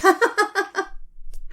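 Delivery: high jeering cackle; mocking laugh. Classmates laugh at Qamar's new nickname, jeering.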